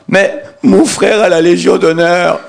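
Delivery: voix haut perchée et lente; protestation aiguë. Retold or imitated. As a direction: plaide d'un ton aigu et outré. Moi, supplie face aux policiers. (je proteste d'une voix aiguë)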